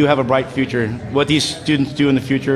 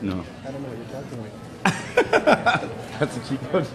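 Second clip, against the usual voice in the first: normal talking voice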